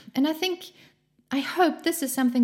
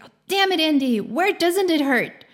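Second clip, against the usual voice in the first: higher and louder exasperated outburst; mock exasperation; 6 semitones higher, 4 dB louder than usual. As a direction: snap at him, fed up, but playful. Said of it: "she loses her temper".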